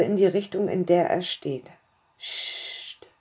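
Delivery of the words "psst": soft unvoiced hush; whisper. Master hushing his blindfolded companion, whispered. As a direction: breathe it out to calm her. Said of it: "flüstert er zurück".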